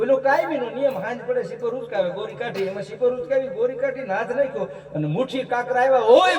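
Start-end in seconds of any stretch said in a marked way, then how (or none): 6.0-6.4 a loud, high cry of shock; the miser shrieks on finding only pebbles; sudden yelp of alarm; retold shriek. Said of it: "કરીને રાડ નાખી".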